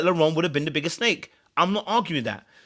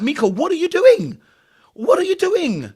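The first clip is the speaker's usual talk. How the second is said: exasperated; 13 semitones higher, 5 dB louder than usual. Retold or imitated. Exasperated at Mika's behaviour.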